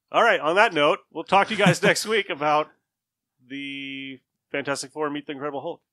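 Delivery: loud, upbeat outro to close the show; podcast sign-off. Real, not acted. Host signing off to listeners, loud upbeat outro.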